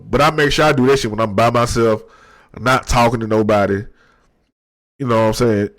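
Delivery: normal talking voice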